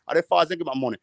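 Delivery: usual speaking voice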